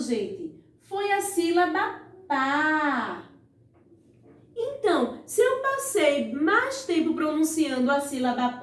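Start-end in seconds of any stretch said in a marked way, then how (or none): 2.3-3.2 "pá" held long on one vowel; drawn-out syllable. Shown as a demonstration. Teacher gives pupils the answer, "pá" drawn out long. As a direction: stretch out "pá". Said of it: "A sílaba que eu mais demorei pronunciando".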